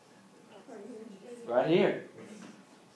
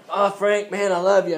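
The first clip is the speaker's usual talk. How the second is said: mock devotion; 5 semitones higher, 8 dB louder than usual. Acts mock heroic rescuer.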